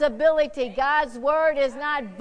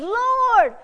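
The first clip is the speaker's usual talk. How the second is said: crying out; 8 semitones higher than usual. Acted. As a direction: call out to Jesus. Blind man cries out to Jesus.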